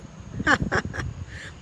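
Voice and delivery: a sly high-pitched rat's cackle; high breathy cackle. A narrator's reading. Botticelli cackling at the mouse and rat. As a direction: cackle with glee at the fight. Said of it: "Botticelli laughed from the sidelines".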